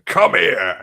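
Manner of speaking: put-on father grabs the boy in the works, angry yell; angry father's shout. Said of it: "He's going".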